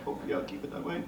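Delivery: chuckling through the words; a real laugh breaks into the line. Real, not acted. Artist joking to the panel audience, laughing. Joke about never being that size.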